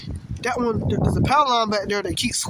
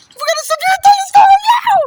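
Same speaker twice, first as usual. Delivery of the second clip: excited shout; 9 dB louder, faster than usual. Real, not acted. Storm excitement, shouting at camera.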